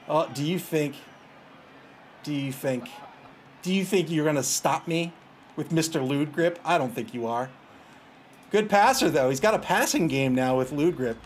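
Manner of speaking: ordinary talk, nothing marked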